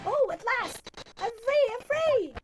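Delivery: high, loud, cheering; freed man cheering in high, loud jubilation; jubilant. Acted. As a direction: shout the alliteration for joy. Natsume celebrates his freedom.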